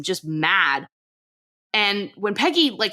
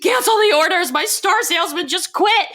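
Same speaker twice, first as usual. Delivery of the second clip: high, loud frantic mock begging; desperate plea; 10 semitones higher, 3 dB louder than usual. Peggy begs Laney to cancel the orders, desperate. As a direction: act out a panicked demand, pitched way up.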